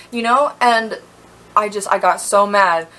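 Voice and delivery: ordinary talk, nothing marked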